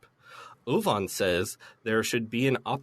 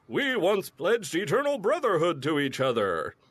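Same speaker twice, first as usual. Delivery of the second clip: dramatic hero voice; 6 semitones higher than usual. Retold or imitated. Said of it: "And Gabby's like".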